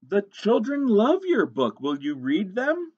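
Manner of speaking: high, loud, slow storybook gushing; delighted librarian. Acted. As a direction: announce thrilling news to a shy author. Librarian delightedly invites the author.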